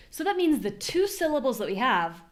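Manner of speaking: normal talking voice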